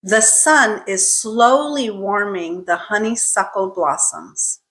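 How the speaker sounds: slow, careful pronunciation demo; model sentence. Shown as a demonstration. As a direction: say it slowly for a learner to repeat, each S clear. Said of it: "I'll say it again".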